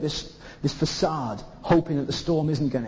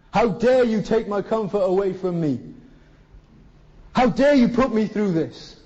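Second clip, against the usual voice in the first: Jonah's indignant rant; 4 semitones higher than usual. Retold or imitated. Jonah protesting to God, indignant and aggrieved. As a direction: rant like a sulking prophet. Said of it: "And he said".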